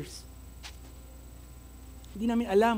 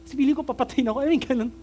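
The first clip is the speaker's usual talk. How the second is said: louder, pressed retelling of weeping; retold anguish; 3 semitones higher, 6 dB louder than usual. Preacher tells congregation of a night of crying, anguished. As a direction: push the words out like remembered sobbing. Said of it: "Umiiyak ako".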